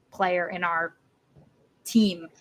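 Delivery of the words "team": ordinary talk, nothing marked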